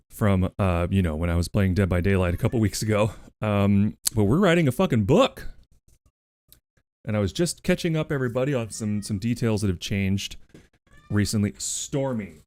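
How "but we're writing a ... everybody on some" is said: ordinary delivery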